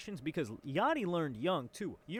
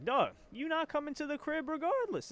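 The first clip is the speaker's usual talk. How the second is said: incredulous outburst; 11 semitones higher than usual. Real, not acted. Streamer scoffing at the DM.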